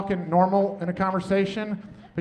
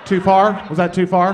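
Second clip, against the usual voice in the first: normal talking voice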